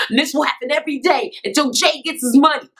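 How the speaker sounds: playfully imitating a yelled threat; mock movie threat. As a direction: shout it like a hammed-up film heavy. Imitating Crush Groove threat.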